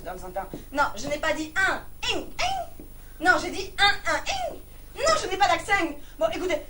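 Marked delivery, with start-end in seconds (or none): 2.1-2.8 "Ing" squeaked high with a twanging nasal ending; exaggerated twang. Caller disputing the number, exaggerated twang on "Ing". 4.2-4.6 "ing" squeaked very high; exaggerated twang. Caller disputing the number, exaggerated twang on "ing".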